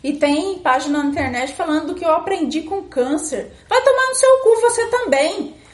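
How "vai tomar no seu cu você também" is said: loud. Acted. Venting at online positivity.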